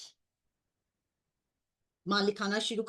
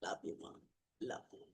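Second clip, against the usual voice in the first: fading whispered love you; 18 dB quieter, slower than usual. Real, not acted. Devotee ending the calling to mother, whispering. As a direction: breathe the words to her, fading.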